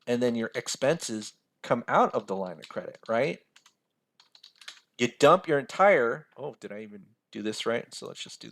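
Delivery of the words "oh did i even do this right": normal talking voice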